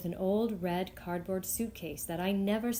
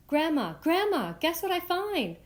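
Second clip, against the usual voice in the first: louder and much higher, bursting through the door; excited yell; 8 semitones higher, 4 dB louder than usual. Acted. Girl runs home to Grandma with the bag, excited yell. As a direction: call out for Grandma, thrilled with the find. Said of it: "I yell".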